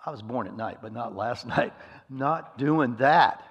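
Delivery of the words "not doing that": slow, loud, emphatic no way; flat refusal. Real, not acted. Preacher refusing to face the principal, slow and emphatic. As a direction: drag it out, dead set against it.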